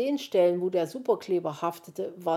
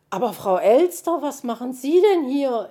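higher, loud burst of surprise; startled exclamation; 7 semitones higher, 10 dB louder than usual. A narrator's reading. Paul suddenly recognises Frau Elster, blurting in surprise. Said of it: "entfuhr es Paul Kautz".

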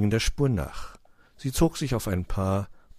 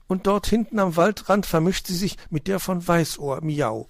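high, lively cat with a meow; cat Mauz, reporting; 10 semitones higher, 5 dB louder than usual. A narrator's reading. Cat telling friends about the tracks, eager. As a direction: report the find as a storybook cat.

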